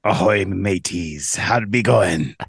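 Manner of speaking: low, slow pirate voice. Acted. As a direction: greet them like an old sea dog. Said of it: "What if you talk like that the whole time".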